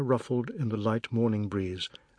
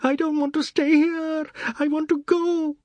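very high, loud shriek; screaming child; 7 dB louder than usual. A narrator's reading. Anand screams to leave after finding dog dead, shriek. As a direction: wail it at the top of the range. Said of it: "a terrible whistle of a shriek".

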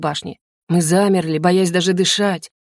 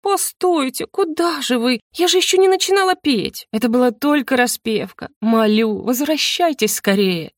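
high theatrical pleading; despairing cry; 5 semitones higher than usual. A narrator's reading. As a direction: beg departing listeners in hammed-up despair. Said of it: "полное отчаяние". (отчаянная мольба высоким голосом)

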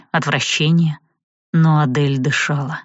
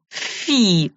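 disgusted; 3 semitones higher than usual. A narrator's reading. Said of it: "элементалистка поморщилась".

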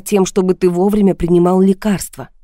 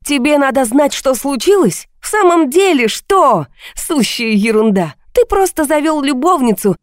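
high-pitched bitter tirade; heated, scornful outburst; angry shouting; 6 semitones higher than usual. A narrator's reading. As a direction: hurl sarcastic accusations in a raised voice. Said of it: "теперь и я кричала".

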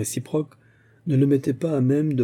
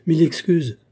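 curt, higher and louder, coldly polite; cold hostile reply; 6 semitones higher, 5 dB louder than usual. A narrator's reading. Ralph coldly answers his rude host, curt and hostile. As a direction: snap back an icy apology. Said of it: "rendu à son sang-froid hostile".

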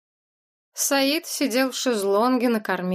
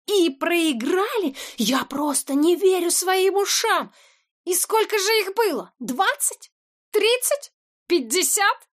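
much higher, slow, ranting; incredulous fury; 9 semitones higher, slower than usual. A narrator's reading. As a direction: rant in disbelief. Boss incredulous rage.

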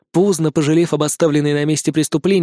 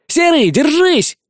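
high, slow, loud shout; calling from afar; 11 semitones higher, 4 dB louder, slower than usual. A narrator's reading. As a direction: call out across the river. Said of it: "донеслось с берега".

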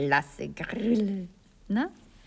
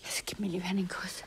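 quick, soft whispered request; 7 dB quieter, much faster than usual. Acted. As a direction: hush it fast to a lover. Lovers' intimate bedroom scene.

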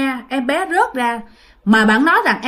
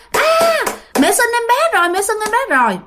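yelling it out; 11 semitones higher than usual. Retold or imitated. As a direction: shout it to the whole house, pitched way up. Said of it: "Mà bạn la làng lên".

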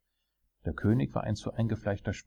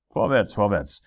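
loud, impatient urging; 3 semitones higher, 8 dB louder than usual. A narrator's reading. King urging on his jester, impatient. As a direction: hurry him along sharply. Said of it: "sagte der König ungeduldig".

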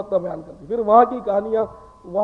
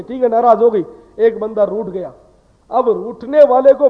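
forceful preaching; 6 dB louder than usual. Acted. Preacher warns the congregation about gossip, forceful preaching. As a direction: drive the warning home from the pulpit.

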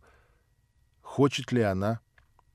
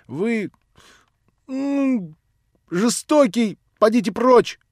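ends throwing him out with a shout; tearful fury; 9 semitones higher, 4 dB louder than usual. Acted. Nelly drives the old man out, high tearful shout.

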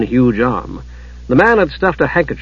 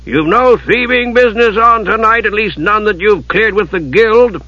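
lightly menacing, higher-pitched warning; ominous; 8 semitones higher than usual. A narrator's reading. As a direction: warn with a hint of threat. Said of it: "in an ominous tone".

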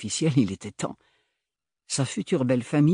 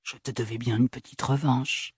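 normal talking voice

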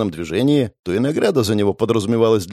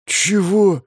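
shocked; 8 semitones higher than usual. Acted. Hero eyes bulging in shock.

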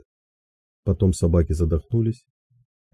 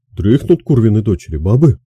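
louder, higher call to rouse sleepers; rough call; 4 semitones higher, 7 dB louder than usual. Acted. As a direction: shout crossly at the sleeping women. Rousing unanswering women.

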